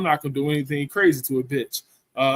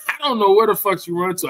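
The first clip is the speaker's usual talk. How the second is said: ordinary talk, nothing marked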